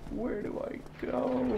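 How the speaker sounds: very high, soft, drawn-out whine; small high helpless plea; lost and helpless. Real, not acted. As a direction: stretch it slowly into a thin high wail. Lost in the game, despairing.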